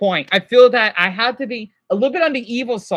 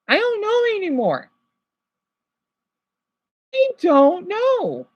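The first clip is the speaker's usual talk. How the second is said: despairing; 8 semitones higher than usual. Real, not acted. Breaking down over mental health.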